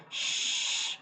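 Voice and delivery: long airy shh; be-quiet shush. Shown as a demonstration. When you say to someone be quiet, long shush. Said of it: "Be quiet".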